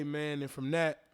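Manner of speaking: usual speaking voice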